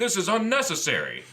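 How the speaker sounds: high, loud mock-prim Xavier; prim evil Xavier. Retelling evil Xavier scolding Jubilee, high haughty mock voice. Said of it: "and he's like".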